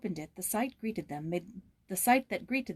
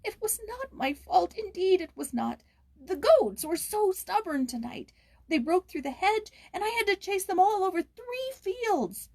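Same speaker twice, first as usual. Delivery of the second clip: put-on a frightened boy begging his master; shrill anxious boy; 13 semitones higher, 4 dB louder than usual. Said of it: "quavered the boy in his shrill, anxious voice".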